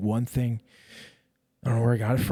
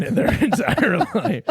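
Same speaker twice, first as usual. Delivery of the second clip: laughing; 12 semitones higher, 8 dB louder than usual. Real, not acted. Laughing at his own joke.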